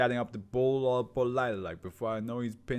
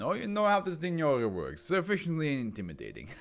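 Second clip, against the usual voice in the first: put-on Italian accent with a chuckle; 6 semitones higher, 3 dB louder than usual. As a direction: sly laugh through an Italian accent. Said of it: "my Italian accent".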